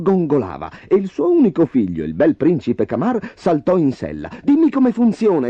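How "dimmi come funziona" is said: put-on high-pitched, eager young prince; eager exclamation. A narrator's reading. Prince asks about the magic horse, exclaiming high and eager. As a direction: burst in with eager curiosity. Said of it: "esclamò".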